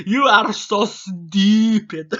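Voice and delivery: high, very slow cartoon-genius sneer; Dexter voice. Vlogger quoting Dexter's catchphrase in a mock cartoon voice. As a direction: drag out each word like a smug boy genius. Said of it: "I always imagine they sound like Dexter".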